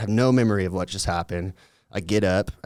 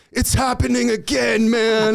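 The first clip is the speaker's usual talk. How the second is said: much higher, shouted freak-out; panicked; 5 dB louder than usual. Retold or imitated. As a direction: wail it, stuck in the loop. Friend retells him panicking, hands on face.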